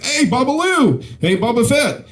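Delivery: high, sing-out nickname greetings; jovial coworkers calling out. Acted. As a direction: hail a colleague with each silly nickname.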